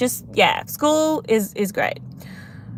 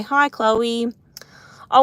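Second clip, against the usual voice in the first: normal talking voice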